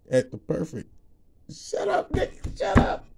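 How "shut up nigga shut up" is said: laughing shut up. Real, not acted. Laughing at himself, scolding jokingly.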